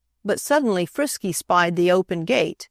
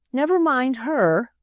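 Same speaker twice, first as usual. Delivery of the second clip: anxious; 6 semitones higher than usual. A narrator's reading. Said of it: "Aunt Sarah said anxiously".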